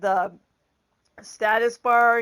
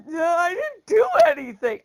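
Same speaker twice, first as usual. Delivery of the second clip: higher, louder protest of innocence; defensive denial; 7 semitones higher, 4 dB louder than usual. Retold or imitated. To husband who says it's broken, a higher, louder defensive denial. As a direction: insist you're blameless, pitched up. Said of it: "And I went".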